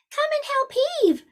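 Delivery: put-on high whispered girl's call; a tiny girl whispering for help; girl's little whisper. Girl calls the dog.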